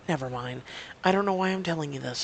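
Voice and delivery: ordinary talk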